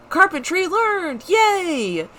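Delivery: high, slow happy cheer; excited cheer. Real, not acted. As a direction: squeal of delight. Celebrates learning a craft.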